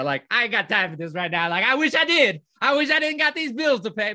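hammed-up plea, building to a high cry; mock exasperated protest. Driver telling Jesus about his bills, loudly protesting. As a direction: voice climbs high and loud on the wish.

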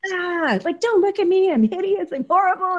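high, loud flustered wail of embarrassment; hammed-up cry of don't look at me; shrieking in mock horror at being seen; mock-horrified self. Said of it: "And I was like".

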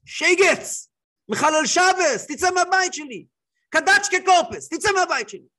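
loud, high angry yelling in a Yiddish-tinged voice; furious shout. Retold or imitated. Rabbi screaming at the visitor to leave his house, furious shout. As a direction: throw him out of the house at full volume. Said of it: "הרב קלמס מרים עליו צעקות".

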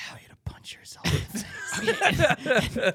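whisper. Real, not acted. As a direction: whisper it with a giggle. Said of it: "I'm gonna whisper".

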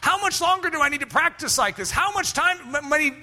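high, impatient whine; a touch of a bored student whining; whiny complaint. Retold or imitated. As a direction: pester for the end. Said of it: "It's like".

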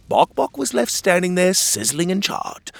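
usual speaking voice

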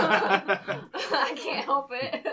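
talking through a real laugh; loud, slow words broken up by laughing; helpless laughter. Real, not acted. Guest teased about their face, helpless laughter.

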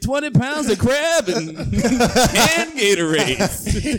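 mock Maryland accent. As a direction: ham up a Maryland crab-feast local. Mocking Maryland crab feast.

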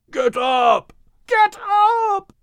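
high urgent shout to a fallen friend; yelling. Acted. Animals yelling at the wounded dog to rise, high desperate shout. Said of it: "were gasping and yelling to Max".

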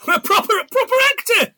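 high, loud delighted cry; bursting with glee; excited laughing exclaim. Real, not acted.

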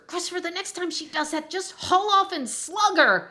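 retold fed-up mother telling her son to hit back; exasperated mom outburst. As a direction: blurt it out like a frazzled mom at the end of her rope. Angry mom tells son to fight.

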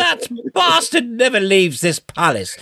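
high, haughty royal command; mock royal order. British royal orders the American locked in the palace, haughty. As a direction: order it like a pompous monarch.

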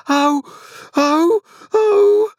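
loud, very high wailing shrieks. A narrator's reading. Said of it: "Each of these exclamations was a shriek".

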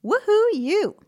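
high, loud whoop of delight; excited cheer. Acted. Host cheering the listener for planning a date, excited whoop. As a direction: cheer them on like a fan.

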